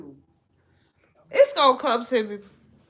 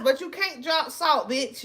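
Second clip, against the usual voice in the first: ordinary talk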